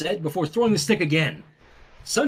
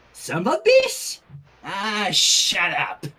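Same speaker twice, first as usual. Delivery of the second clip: put-on slow baby cursing; much slower than usual. Baby voiced swearing, parody.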